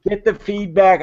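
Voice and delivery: normal talking voice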